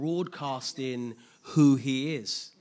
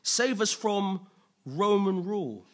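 higher, louder rallying cry, slowing into a plea; crowd crying out; 5 semitones higher, 5 dB louder than usual. Acted. Crowd hailing a king against Roman oppressors, crying out. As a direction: call out like a crowd hailing its rescuer. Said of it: "So they're like".

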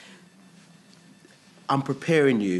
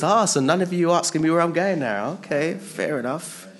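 offhand shrug; 8 semitones higher, 5 dB louder than usual. Retold or imitated. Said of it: "I don't think Jesus said it like that".

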